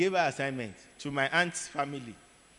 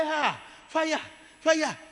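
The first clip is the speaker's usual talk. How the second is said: higher, louder cries of fire; panicked cry; 13 semitones higher, 4 dB louder, slower than usual. Retold or imitated. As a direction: cry out as if burning. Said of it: "He said".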